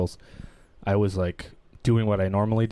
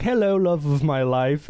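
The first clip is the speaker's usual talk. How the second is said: slow, higher sweet-talk to a loved one; mock tender greeting; 6 semitones higher, 4 dB louder, slower than usual. Husband sweetly greeting his wife, lovingly slow. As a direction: coo it lovingly, drawn out.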